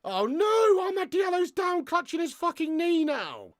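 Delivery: high, wailing cry of dismay; drawn-out, high-pitched anguished commentary; alarmed dismay. Real, not acted. Dismay at player injured on pitch.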